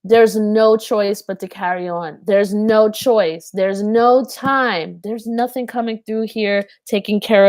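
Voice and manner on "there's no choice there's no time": slow insistence. Retold or imitated. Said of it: "He's not screaming at you".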